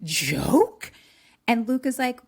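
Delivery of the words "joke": loud, slow, higher-pitched indignation; outraged demand. Retold or imitated. Girl confronting the boys who scared her, outraged. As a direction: draw it out in angry disbelief.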